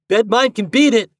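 put-on high, loud boyish boast. A narrator's reading. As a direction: challenge a friend proudly. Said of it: "boasted Reddy".

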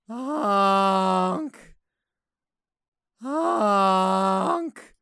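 drawn-out rising goose honk. Shown as a demonstration. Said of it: "here's how you speak to geese".

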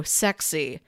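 ordinary talk, nothing marked

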